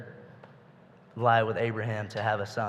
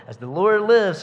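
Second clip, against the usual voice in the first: swearing loudly, pitched higher; solemn oath; 7 semitones higher, 8 dB louder than usual. Retold or imitated. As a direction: vow it out loud like a king. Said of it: "and Saul swore".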